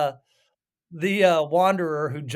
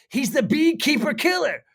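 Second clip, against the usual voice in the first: excited; 10 semitones higher, 6 dB louder than usual. Retold or imitated. Recreating movie dialogue banter.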